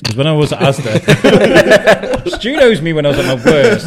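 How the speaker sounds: loud, slow, laughing through it. Real, not acted. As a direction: laugh while admitting it. Laughing about his past.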